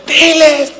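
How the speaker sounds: urgent urging. Retold or imitated. Urging again.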